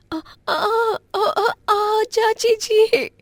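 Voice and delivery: very high, wailing cries of pain; crying out in pain. Acted. Beaten niece crying out to her aunt in pain. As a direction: wail as the blows land.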